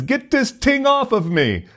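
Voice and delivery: high-pitched French Canadian cry; French Canadian accent. Retold or imitated. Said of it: "he just began to cry out".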